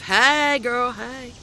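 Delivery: high, bright-pitched sassy hello; a playful high girlfriend greeting; hey girl greeting. Pretend. Greeting voice while showing shawl.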